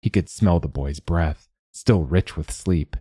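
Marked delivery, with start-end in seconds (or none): none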